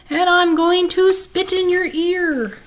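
high, loud ghostly howl; spooky ghost howl. Acted. Bean playing ghost to scare her sister, loud spooky howl. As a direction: wail it like a ghost trying to scare. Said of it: "she howled in her spooky voice".

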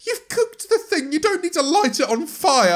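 high, loud exasperated rant; incredulous outrage. Acted. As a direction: protest in high disbelief. Outrage at sparklers.

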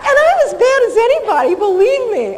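high, loud laughter through the words; laughing. Real, not acted. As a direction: crack up at the memory. Laughing at the dogs' reaction.

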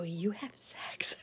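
slow, hushed, disbelieving question; stunned whisper. Retold or imitated. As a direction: gasp it out to the older brother. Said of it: "I go".

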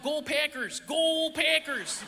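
drawn-out Wisconsin "Go Packers" chant; Wisconsin accent. Retold or imitated. Wisconsin fans cheering on their team, thick Wisconsin accent. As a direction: stretch the vowels like a Wisconsin fan. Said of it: "It was like".

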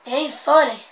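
usual speaking voice

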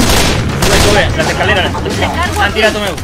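loud, urgent directions; shouting directions. Real, not acted. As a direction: call it out across the map. Guiding teammates in combat. (gritando indicaciones)

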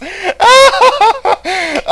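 shrieking laugh, high and loud. Real, not acted. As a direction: burst into a high cackle. Laughing at own bit.

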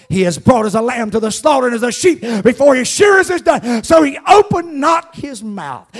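preaching. Acted. Preacher chanting Isaiah to the congregation, high and loud. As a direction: chant each line up to the rafters.

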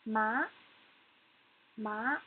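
"Ma" sliding upward; rising tone. Shown as a demonstration. Teacher demonstrates the second tone, "Ma" rising. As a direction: lift the pitch through "Ma" to the end. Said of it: "second tone needs to go up".

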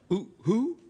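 owl hoot. Pretend. Owl-like hooting joke.

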